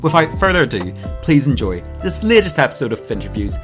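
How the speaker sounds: upbeat host intro over music; bright radio-style show opener; podcast intro read. Acted.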